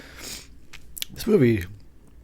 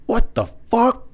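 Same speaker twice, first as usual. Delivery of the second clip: exaggerated outrage; 11 semitones higher, slower than usual. Repeats with bigger outrage.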